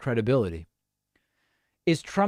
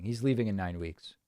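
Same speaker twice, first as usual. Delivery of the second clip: quieter, low, "leaving" said gently as a calm close; softer repeat; 5 semitones lower, 7 dB quieter than usual. Real, not acted. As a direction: let it fall away softly as a final word. Repeats answer, softer second time.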